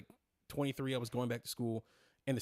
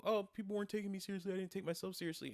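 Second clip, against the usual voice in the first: mocking quote; 7 semitones higher, faster than usual. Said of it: "again, it's like the whole".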